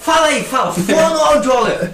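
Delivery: high, loud, drawn-out mocking teacher. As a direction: jeer it slowly like a nasty teacher. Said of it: "Era exatamente assim".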